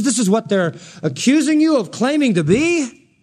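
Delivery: usual speaking voice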